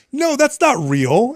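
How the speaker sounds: put-on high, slow kid denial; imitating his son. Son refusing to believe dad's fake injury, high slow kid voice. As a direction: stretch it out like a stubborn little boy. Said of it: "He goes".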